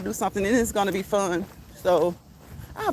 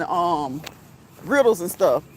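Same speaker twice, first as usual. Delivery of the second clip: ordinary talk, nothing marked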